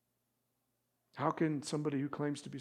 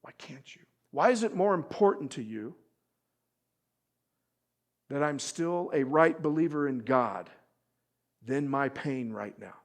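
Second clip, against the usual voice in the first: Job's anguished plea; 3 semitones higher, 8 dB louder than usual. Retold or imitated. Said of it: "This point right here".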